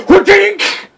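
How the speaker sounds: high, loud comic impact noise; imitated attack sound. Boss landing on a party member, imitated impact. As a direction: voice the hit like a cartoon sound effect.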